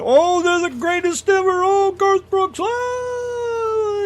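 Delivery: high, swooning fan worship; mock fan gushing. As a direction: gush like a starstruck fan. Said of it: "are treated like Jesus or something".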